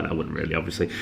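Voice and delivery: dropped, low and soft; quiet aside. Real, not acted. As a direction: mutter it as a quick retraction. Takes back joke.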